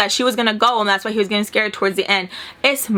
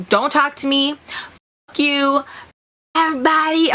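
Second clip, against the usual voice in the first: mildly heated, rising in pitch; retold anger; 3 semitones higher than usual. Telling off the guy who grabbed her, mildly mad. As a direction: replay a mad brush-off with pauses between bursts. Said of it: "I was all mad".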